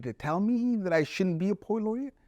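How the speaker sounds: mildly outraged disbelief; indignant. Retold or imitated. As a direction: voice an offended inner protest. Acted indignant inner reply to juror.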